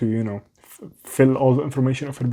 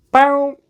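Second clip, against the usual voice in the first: imitated blown speaker; 14 semitones higher, 7 dB louder than usual. Imitating the broken phone speaker.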